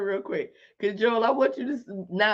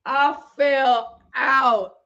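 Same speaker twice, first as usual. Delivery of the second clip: slow, louder words through laughter; laughing; 4 semitones higher, 5 dB louder, much slower than usual. Real, not acted. Telling viewers the funny part, laughing. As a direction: crack up telling it.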